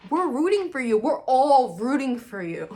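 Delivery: loud, high, emphatic cheer; hammed-up rooting cry. Fan rooting for the couple, loud impassioned cry. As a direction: holler it high, stretching "all".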